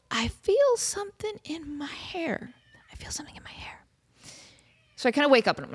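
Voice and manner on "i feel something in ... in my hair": breathy eerie whisper; hushed ghost-story whisper; spooky whisper. Acted. Eerie story building suspense.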